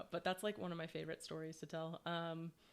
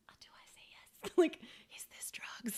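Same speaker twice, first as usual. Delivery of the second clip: unvoiced-sounding mock whisper, unsure; nervous whisper; 9 semitones higher, 3 dB louder than usual. Newcomer offered a beer at a bonfire, whispering.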